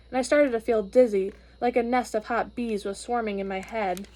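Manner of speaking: ordinary delivery, nothing marked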